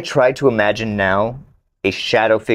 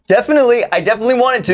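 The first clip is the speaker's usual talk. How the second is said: high mock agreement; sarcastic; 11 semitones higher, much faster than usual. Real, not acted. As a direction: overdo it, pitched way up. Exaggerated agreement.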